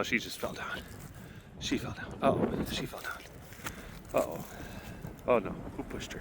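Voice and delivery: hushed unvoiced whisper; soft whispered commentary as she lies down. Real, not acted. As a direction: whisper so the cat isn't disturbed. Whispering while watching lion.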